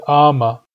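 ama called out loud for the class; loud demo word. Shown as a demonstration. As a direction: say ama boldly and clearly. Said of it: "is a loon".

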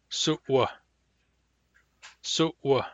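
glottalized w in "su'wa"; hard W. Shown as a demonstration. Teacher giving the example word for hard W, glottalized "su'wa". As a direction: cut "su'wa" with a glottal catch before the w. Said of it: "For hard W".

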